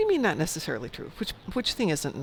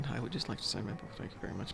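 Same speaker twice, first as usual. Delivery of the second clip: mumbling fan; 4 semitones lower, 10 dB quieter, faster than usual. Retold or imitated. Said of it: "stand in line going".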